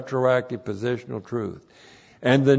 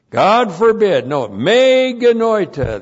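loud, high, forceful denial; very emphatic; 7 semitones higher, 8 dB louder than usual. Shown as a demonstration. Preacher correcting the King James translation, very emphatic. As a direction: proclaim it with rising force. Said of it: "very emphatic".

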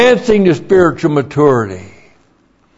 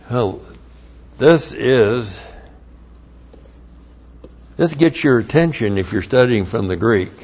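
normal talking voice

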